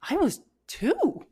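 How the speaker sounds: amazed. Real, not acted. Telling how intense her meltdown was, a high amazed burst. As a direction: say it like you can't believe it yourself.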